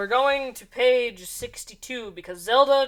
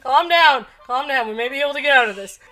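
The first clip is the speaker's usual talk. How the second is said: loud panicked; 3 semitones higher, 9 dB louder, faster than usual. Acted. Zelda panics at the witch.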